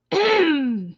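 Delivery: forceful throat clear after choking. Real, not acted. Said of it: "It just went down the wrong pipe".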